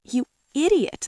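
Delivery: mild groan of a desperate man. A narrator's reading. Parched traveler to the tie seller, groaning. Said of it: "the traveler groaned".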